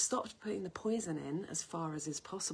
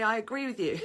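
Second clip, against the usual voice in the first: laughing through the quote; 5 semitones higher, 8 dB louder than usual. Retold or imitated. Retold friend's meaning, laughing.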